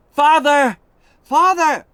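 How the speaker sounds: high, loud calls for her father; desperate shout. A narrator's reading. Alice calling to her father on the wall, desperate shouting. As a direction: cry out to be recognised. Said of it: "Alice shouted".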